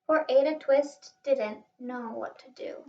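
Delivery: ordinary delivery, nothing marked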